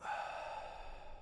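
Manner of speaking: long soft breath out; calming, drawn-out exhale; slow exhale. Shown as a demonstration. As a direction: release a held breath slowly. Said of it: "and hold that breath".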